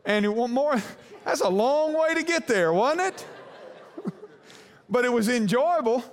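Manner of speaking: high, laughing aside to the congregation. Real, not acted. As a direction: laugh at the long detour.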